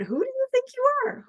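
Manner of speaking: curious delight. Shown as a demonstration. Coach reframing a harsh question, high and delighted. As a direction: ask it as a joyful open question. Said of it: "What if we turn that around?".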